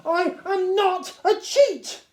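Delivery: high, loud, slow defiant yell; boy shouting back in blind fury; furious shout. A narrator's reading. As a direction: hurl it back at the teacher. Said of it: "I shouted back at him".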